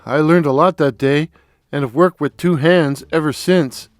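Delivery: ordinary delivery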